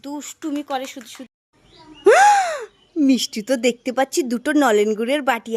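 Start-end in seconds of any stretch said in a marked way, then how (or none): 2.0-2.7 high startled gasp; a sudden loud gasp of surprise; sharp gasp. Acted. Mother suddenly spotting the sleeping children, loud startled gasp.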